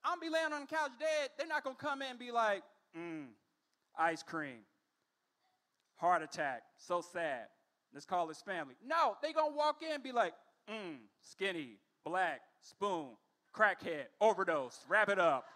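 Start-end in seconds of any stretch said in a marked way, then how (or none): 2.9-8.8 mock paramedics. Imagined sympathetic paramedics. 10.7-15.5 dismissive paramedics. Pretend.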